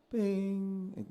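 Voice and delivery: "Ping" sung high, a little sharp, above the fork; sharp note. Retold or imitated. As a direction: overshoot the note on the high side. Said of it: "you're a little sharp".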